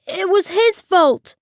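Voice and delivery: high, sobbed-out blame; sobbing. A narrator's reading. Captain blaming the coach for a broken cup, sobbing. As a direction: blame him in a high, mock-tearful whine. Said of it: "sobbed Steve".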